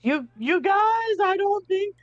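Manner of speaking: high, strained hushed yell; whisper shouting. Acted. Player warning the split-up crew, high whisper shout. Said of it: "I'm, like, s-, whisper shouting".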